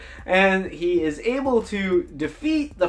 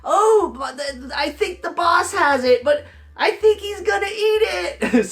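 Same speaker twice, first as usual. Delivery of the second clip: high, whiny goblin rising to a wail; a frightened goblin blurting bad news; cornered goblin squealing about the boss; panicky goblin; 10 semitones higher than usual. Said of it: "I said".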